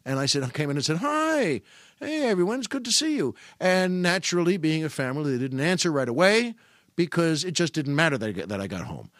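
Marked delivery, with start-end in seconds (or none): 1.0-3.3 bright, much higher cheery greeting; singsong dad hello. Retold or imitated. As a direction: breeze in sounding happy to be home. Cheery greeting entering home.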